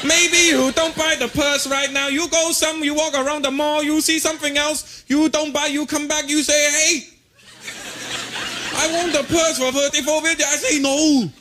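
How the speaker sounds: high, slow mock Chinese accent; Chinese shopkeeper accent. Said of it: "do the right thing".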